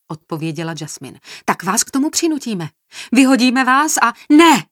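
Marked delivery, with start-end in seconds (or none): 1.4-4.1 raised voice building to a shout; heated, escalating outburst; angry threat. A narrator's reading. 4.3-4.7 high, very loud cry; full-voiced high outburst; shouted refusal. A narrator's reading. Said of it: "vykřikla Erika".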